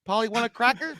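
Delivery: lightly high, coaxing sing-song; imitating the parrot line. Friend quoting the blind boy calling the bird, coaxing. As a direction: coax it like talking to a bird. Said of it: "gets like".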